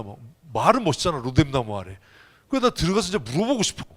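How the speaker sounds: ordinary delivery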